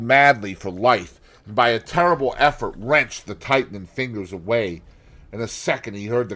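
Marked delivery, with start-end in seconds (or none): none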